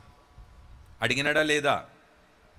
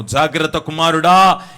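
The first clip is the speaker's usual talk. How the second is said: mother's loud warning; 12 dB louder, slower than usual. Retold or imitated. Mother warning her son.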